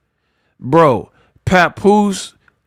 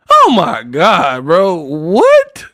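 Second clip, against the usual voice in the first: stunned disbelief; 2 semitones higher, 3 dB louder, much slower than usual. Real, not acted. Reacting to absurd quote.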